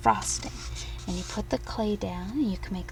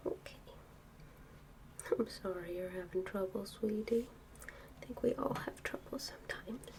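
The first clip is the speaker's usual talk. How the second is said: soft hushed whisper at bedtime; pillow talk; 14 dB quieter than usual. Real, not acted. Whispering to sleepless child.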